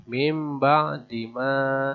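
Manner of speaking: "mim ba di ma" slow with m before b; iqlab reading. Shown as a demonstration. Teacher recites the example for learners. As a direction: model "mim ba di ma" slowly. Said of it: "Seperti ini".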